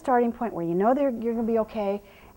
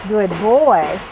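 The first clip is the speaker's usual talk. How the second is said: loud firm praise; 3 semitones lower, 11 dB louder than usual. Real, not acted. Trainer loudly praising the horse past the wheelbarrow. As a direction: call out praise as he passes.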